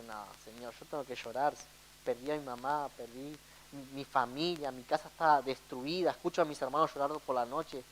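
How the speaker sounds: low, soft retold plea; quietly protest, as if worn down by grief; subdued sorrowful protest. Man telling God he has to cry, subdued and sorrowful.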